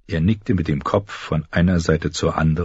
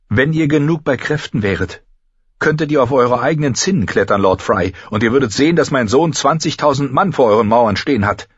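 higher, firm noblewoman, a touch of anger; Caitlin's voice; 7 semitones higher, 4 dB louder, faster than usual. Acted. Caitlin threatening Lord Frey with her son's army, firm. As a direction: hold back a flare of anger.

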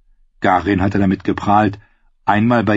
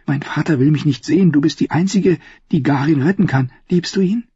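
persuasive princess; 7 semitones higher than usual. A narrator's reading.

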